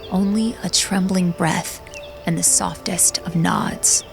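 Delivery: ordinary delivery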